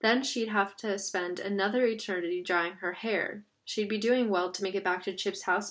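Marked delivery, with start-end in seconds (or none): none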